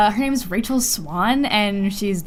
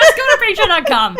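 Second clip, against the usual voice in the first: laughing through it; 13 semitones higher, 7 dB louder than usual. Real, not acted. Repeating plug while cracking up.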